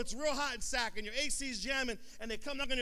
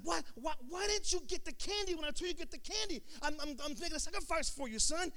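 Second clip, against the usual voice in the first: higher-pitched, exasperated father scolding his son; acted indignation, stammering and pitched up; mad dad imitation; 8 semitones higher, 4 dB louder than usual. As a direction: plead and scold as a frustrated parent. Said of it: "And the dad's all mad".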